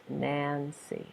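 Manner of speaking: NANCY softly broken into two syllables; Nancy in two separate, even beats; split into syllables. Shown as a demonstration. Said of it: "there's two syllables".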